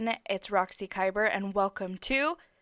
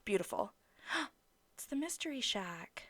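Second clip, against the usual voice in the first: real hushed whisper; awed whisper; 3 semitones higher, 10 dB quieter than usual. Real, not acted. Streamer first sees the Mystery Shack, awed whisper. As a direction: whisper it in wonder.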